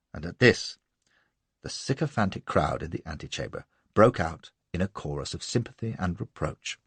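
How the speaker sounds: usual speaking voice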